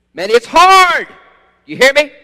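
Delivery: high, loud impassioned preaching; preaching shout. Real, not acted. Preacher calling out to his congregation, fervent shout. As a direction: hammer it home to the congregation.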